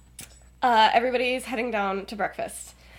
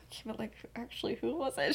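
very soft, quick question; pry under your breath; hushed prying; 16 dB quieter, faster than usual. Retold or imitated. Acted secretive asking.

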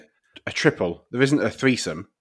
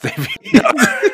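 laughing; 5 dB louder than usual. Real, not acted. Teasing friend by name.

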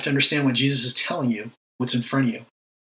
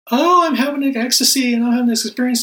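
mock ecstasy; 10 semitones higher, 4 dB louder than usual. Mocking showy mystic ecstasy.

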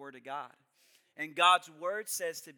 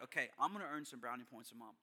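quick self-talk; 5 semitones lower, 8 dB quieter, faster than usual. Retold or imitated. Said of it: "I was like".

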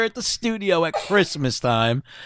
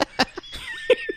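stifled giggles; 4 dB quieter than usual. Real, not acted. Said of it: "They're trying to not laugh".

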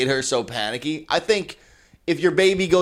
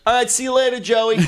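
higher, chummy farewell; friendly old guy; 9 semitones higher than usual. Retold or imitated. Forty-year-old man saying bye to a five-year-old, chummy and higher. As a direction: wave him off like an old buddy. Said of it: "the guy goes".

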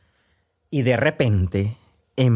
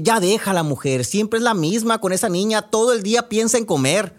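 higher, fed-up dad from his cot; lightly voiced grumbling father; 8 semitones higher than usual. Father on his cot telling the mother to leave the girl, fed-up. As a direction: grumble at the wife to let it go. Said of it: "replicó el papá".

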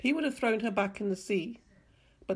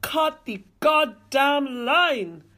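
loud, higher, slow shout; 6 semitones higher, 8 dB louder, much slower than usual. A narrator's reading. Nicer shouting at the men on the boat to cut the line, shouted. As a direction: shout it out, drawn out and urgent. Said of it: "shouted Nicer Country".

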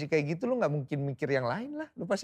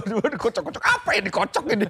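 high, loud panicked yelp; panicked cry; 8 semitones higher, 6 dB louder than usual. Retold or imitated. Retelling the brake failure, a high panicked cry. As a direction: yelp it like the car is failing. Panicked retold shout in car.

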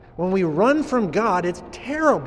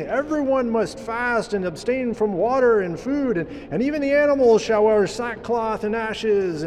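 a king proclaiming his decree; lightly voiced royal proclamation, slightly higher; 6 semitones higher than usual. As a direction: announce it like a herald of the king.

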